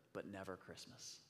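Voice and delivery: ordinary delivery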